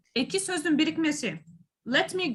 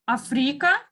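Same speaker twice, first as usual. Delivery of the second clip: "Africa" with the middle syllable stressed; stress on second syllable; 4 dB louder than usual. Shown as a demonstration. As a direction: lean on the "fri" in "Africa". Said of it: "there is three stressed syllables".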